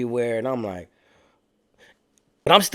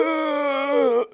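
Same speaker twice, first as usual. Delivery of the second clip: high, drawn-out wheezing groan; imitated winded gasp; 10 semitones higher than usual. Stiffly walking to the grass, imitated winded gasp. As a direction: suck for air as if winded. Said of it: "looking like a scarecrow, like".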